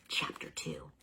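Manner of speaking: hushed unvoiced whisper. A narrator's reading. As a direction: breathe the chapter title. Whispered chapter heading.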